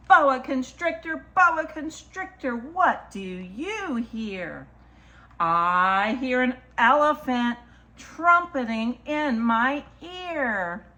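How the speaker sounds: slow, lilting picture-book read; reading to children. Acted. Storyteller reads a picture book to children, lilting. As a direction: read it rhythmically, stretching the key animal words.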